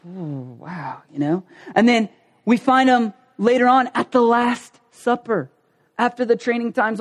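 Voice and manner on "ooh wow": very soft, low stunned wow; hushed, chastened reaction; humbled awe. Retold or imitated. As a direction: imitate being quietly taken aback. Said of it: "they just go".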